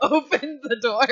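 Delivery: loud, high burst through laughter; laughing. Real, not acted. Narrating the scene to the players, laughing hard. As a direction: crack up while getting the words out.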